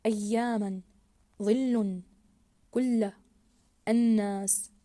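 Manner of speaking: "أياما، ظل، كل الناس" word by word, each doubled letter held; stressed doubled letters. Shown as a demonstration. Teacher rereading the words for pupils, stressed doubled letters. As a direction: press loudly on ي, ل, ل, ن in "أياما، ظل، كل الناس". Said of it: "سنعيد قراءة الكلمات".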